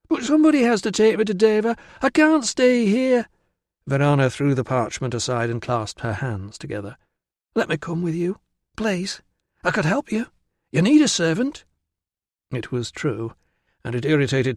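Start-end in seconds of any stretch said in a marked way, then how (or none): 0.1-3.3 high, urgent rush slowing into a wail; desperate pleading. Acted. Virana begs Tilla for passage to Deva, desperate. 7.6-11.6 put-on high, beseeching girlish voice; pleading girl. Virana begs Tilla to take her along, pleading.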